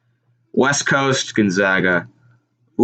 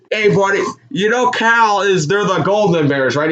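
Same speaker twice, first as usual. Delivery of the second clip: teasing announcer; 8 semitones higher than usual. Retold or imitated. Announcer teasing a fan on camera, higher mock voice. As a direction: needle the fan playfully.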